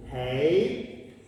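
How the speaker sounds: HEY dipping then rising; HEY like asking a question. Shown as a demonstration. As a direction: start HEY mid, go down, then up. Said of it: "like when you ask a question".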